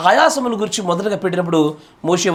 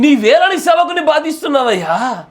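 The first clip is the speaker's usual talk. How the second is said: Moses complaining; 6 semitones higher, 4 dB louder than usual. Retold or imitated. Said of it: "అప్పుడు మోషే అంటాడు".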